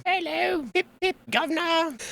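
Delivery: high-pitched cartoon British accent; British little guy. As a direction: squeak it like a tiny British chap. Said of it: "he's gotta be British".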